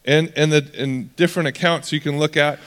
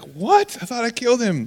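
high, startled disbelief; shocked surprise; 9 semitones higher than usual. Retold or imitated. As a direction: Herod caught off guard, pitch jumping up. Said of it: "Herod's like".

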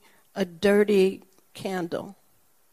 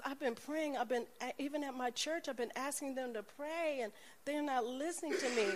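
soft pleading; 5 semitones higher, 11 dB quieter than usual. Retold or imitated. Woman tells of unanswered prayers.

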